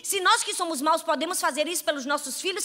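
usual speaking voice

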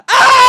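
sudden high, very loud scream; cathartic scream. Shown as a demonstration. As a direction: let it all out in one long scream. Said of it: "to scream with me".

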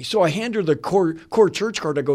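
ordinary talk, nothing marked

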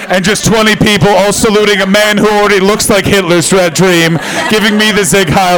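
loud, laughing, fast-talking refusal; loud laughing rant. Real, not acted. To the crowd, refusing the salute in a loud laughing rant. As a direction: push through the laugh, loudly.

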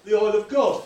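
high, loud, imitated enthusiasm; mock delight. Preacher imitates people welcoming leaked oil, loud delight. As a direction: welcome it with acted, overjoyed gusto. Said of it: "some people go".

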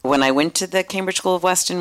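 ordinary talk, nothing marked

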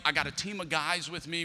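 ordinary talk, nothing marked

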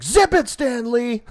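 loud, high-pitched, drawn-out mock yell; acted movie retort. Retelling Deadpool's retort to a friend, loud mock yell. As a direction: belt out the comeback, stretched and high. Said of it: "And he's like".

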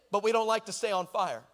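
normal talking voice

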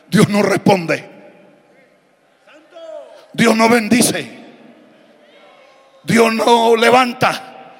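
normal talking voice

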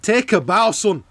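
Scottish football commentator voice; Scottish commentator accent. Retold or imitated. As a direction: do a Sky pundit in a Scottish accent. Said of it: "If anyone's wondering what that accent is".

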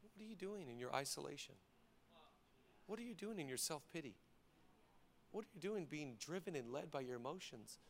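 God's soft rebuke. Retold or imitated. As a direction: press gently, almost hushed. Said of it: "God's like".

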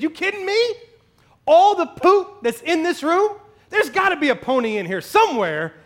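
a lightly voiced eager kid, high and bright with disbelief; incredulous delighted kid. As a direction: toss it off like it's obvious and wonderful. Optimistic kid's delighted punchline.

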